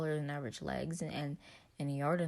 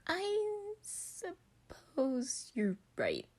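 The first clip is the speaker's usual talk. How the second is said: very slow, high whispered reply; whispered amused agreement; 9 semitones higher, much slower than usual. Acted. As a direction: breathe it out gently. Pat answers softly, far voice.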